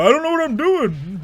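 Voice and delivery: high, loud self-parody of being lost; hammed-up lost player voice; mock clueless gamer. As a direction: whine it like a helpless newbie. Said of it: "'cause I'm like".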